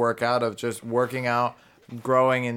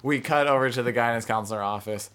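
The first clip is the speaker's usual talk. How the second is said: ordinary delivery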